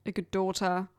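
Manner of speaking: usual speaking voice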